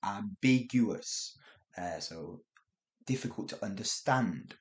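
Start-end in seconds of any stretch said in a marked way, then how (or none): 0.1-1.4 ambiguous said slowly, each syllable clear; slow key word. Shown as a demonstration. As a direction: spell out ambiguous slowly for learners. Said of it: "Remember that word".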